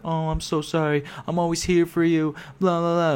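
high-pitched mocking imitation of sympathy; fake sympathy. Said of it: "A bunch of fucking fake nonsense".

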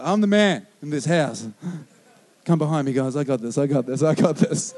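low, chest-puffing hammed-up hero voice; mock macho. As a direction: play the proud family protector. Mock macho protector of family.

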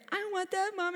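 put-on high whiny toddler begging; a mother imitating her little boy's whine; whiny kid voice. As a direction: beg like a small child wanting a treat. Toddler begging at grocery store.

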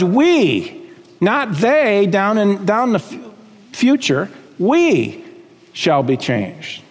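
"we" held long, set against "they"; stress on WE. Real, not acted. Preacher including listeners in the prophecy, stressing "we". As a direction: punch each "we" and let it hang. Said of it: "Why do I keep emphasizing the word".

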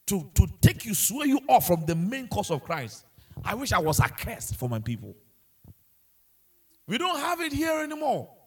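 full-force sermon climax; impassioned preaching. Acted. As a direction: preach it with rising fire.

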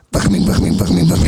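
rasping demonic whisper; Exorcist demon voice. Retold or imitated. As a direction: growl it like a possessed girl. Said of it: "Exorcist. You know, she's like".

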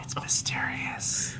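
slow, secretive whisper; mysterious whisper. Pretend. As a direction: hush it like a secret. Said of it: "I like to whisper".

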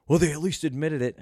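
mock believer voice. Mocking an imagined believer who defends the government. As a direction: parrot the gullible guy's excuse.

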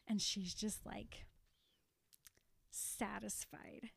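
soft unvoiced whisper; quiet smug whisper. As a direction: breathe it out, satisfied. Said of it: "whispered satisfied".

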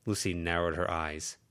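ordinary delivery, nothing marked

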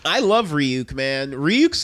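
usual speaking voice

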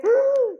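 sharp high gasp of fright; mock startled cry, pitched high. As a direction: gasp as if a bus just missed you. Startled by a near-miss bus.

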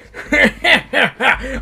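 high hammed-up villain cackle; villain laugh. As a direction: cackle like a cartoon baddie. Villain's laugh before introduction.

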